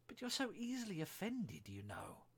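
high, plaintive protest; plaintive girl appealing to an offended friend; pleading. A narrator's reading. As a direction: plead with pitch lifted, dropping at the end. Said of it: "pleaded poor Alice".

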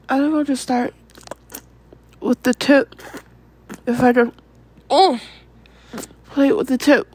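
breathy and urgent, broken by gasps and long pauses; breathless arousal. Acted. Girlfriend in an intimate moment with partner, breathless.

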